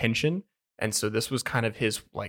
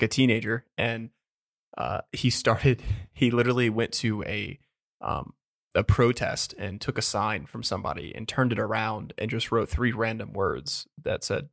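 usual speaking voice

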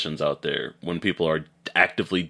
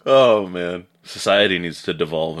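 ordinary talk, nothing marked

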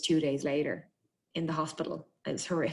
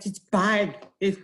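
slow, broken stammer; imitated juddering speech; teeth chattering; 4 semitones higher, 4 dB louder, slower than usual. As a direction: act out failing to talk through a shaking jaw. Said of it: "I couldn't talk through it".

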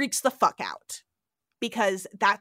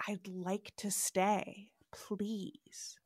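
soft, slow, breathless whisper; whispered submission; 10 dB quieter, much slower than usual. Retold or imitated. Marla giving in to Khan, a soft timid whisper. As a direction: barely dare to say it.

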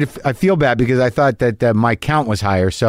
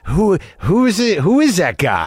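higher, lightly voiced puzzled asking; puzzled self; 6 semitones higher than usual. Host acting out his own puzzlement about a comic's name, higher. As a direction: play a mildly baffled onlooker. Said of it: "I'm like".

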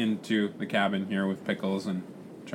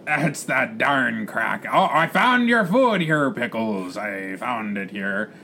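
pirate captain; much slower than usual. Acted. Captain finds the parrot's food, pirate voice. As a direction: exclaim the find, then settle into a growly drawl.

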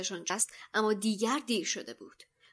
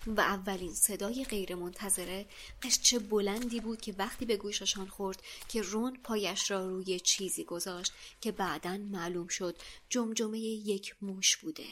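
ordinary delivery

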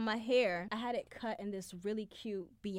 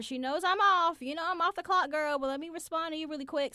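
higher, louder, sassy complaint; annoyed sass; 8 semitones higher, 4 dB louder, faster than usual. Retold or imitated. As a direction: roll your eyes at the boss. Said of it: "So I'm like".

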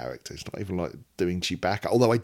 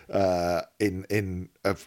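ordinary talk

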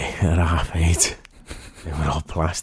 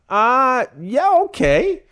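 hesitant okay; 4 dB louder, much slower than usual. Retold or imitated. Own reply in retold story.

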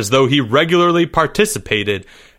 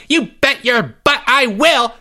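very high, heated outburst; high-pitched boyish defiance; furious acceptance; 12 semitones higher than usual. A narrator's reading. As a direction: take the dare at a squeaky yell. Climax of the boys' quarrel.

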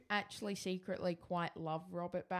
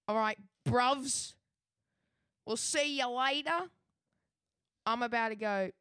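Eggsy; 4 semitones higher, 3 dB louder than usual. Acted. Co-host made to sign off as Eggsy, badly faked South London accent. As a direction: put on a hammy South London lilt. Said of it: "You cannot escape from this Eggsy accent".

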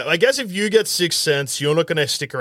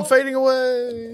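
high, wispy fading ghost; overacted death scene; 8 semitones higher than usual. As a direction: play the ghost dissolving.

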